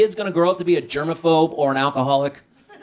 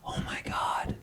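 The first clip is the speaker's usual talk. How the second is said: mock horrified whisper; 8 dB quieter than usual. Husband hearing wife is pregnant, whispered mock horror. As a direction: gasp then whispered dread.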